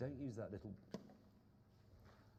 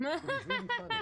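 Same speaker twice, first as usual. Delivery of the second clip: high, loud, laughing mock of a smug joke teller; a laughing, hammed-up boast that the joke was funny; 13 dB louder than usual. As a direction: send up someone praising their own joke. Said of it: "start off by going".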